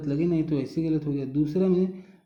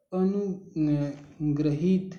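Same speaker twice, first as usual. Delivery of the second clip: अनुग्रहित drawn out in separate pieces, a-nu, gra-hit; very slow, broken अनुग्रहित; sounded out slowly; much slower than usual. Shown as a demonstration. Teacher checking an option for students, word sounded out slowly.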